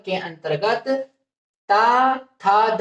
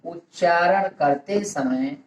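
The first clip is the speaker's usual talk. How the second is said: word by word with long pauses; dictation. Real, not acted. Teacher giving students a definition to write down, measured dictation. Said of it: "परिभाषा के रूप में लिख लिया जाए".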